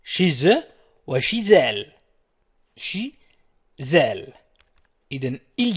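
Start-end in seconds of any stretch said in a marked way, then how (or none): 0.0-1.9 "Chez eux" and "chez elle" with a clear linking z; shee-ZUH rising, shee-ZAL falling; model liaison. Shown as a demonstration. Teacher repeating the model for students, liaison Z. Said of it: "أنتم حاولوا أن تقولوا". 2.8-4.3 "Chez elle" broken into shee, pause, ZAL; split liaison. Shown as a demonstration. Teacher breaking it down for students, syllable by syllable. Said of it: "حاولوا أن تقولوا".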